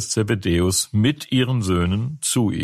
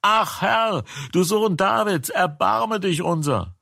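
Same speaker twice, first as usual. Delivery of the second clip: higher still, desperate pleading cry; the same plea, pitched even higher with more urgency; 10 semitones higher than usual. A narrator's reading. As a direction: push the plea further up, begging harder. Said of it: "Aber sie schrien noch mehr".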